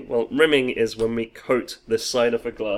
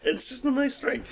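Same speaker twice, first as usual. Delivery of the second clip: laughing; 14 semitones higher than usual. Real, not acted. Laughing while saying it.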